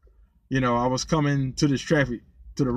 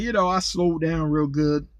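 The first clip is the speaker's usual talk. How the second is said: ordinary talk, nothing marked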